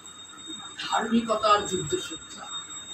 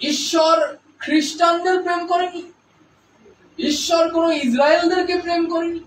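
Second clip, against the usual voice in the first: fervent preaching; 5 semitones higher than usual. Acted. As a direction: proclaim it to the whole church. Preaching at full force. (উচ্চস্বরে জোরালো প্রচার)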